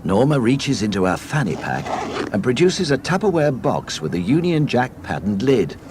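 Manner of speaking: ordinary talk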